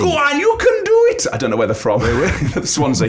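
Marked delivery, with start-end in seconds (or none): 0.0-1.3 high, loud mock cheer; crowd cheering. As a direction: shriek encouragement like a race spectator. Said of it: "the cheering's coming from the side".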